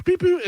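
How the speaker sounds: high electronic beep-beep; quick high-pitched beeps; imitated phone beep. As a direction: mimic a phone's payment chirp. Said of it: "his phone makes a noise".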